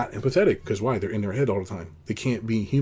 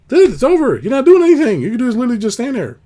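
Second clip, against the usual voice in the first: exasperated outburst; 12 semitones higher, 10 dB louder than usual. Real, not acted.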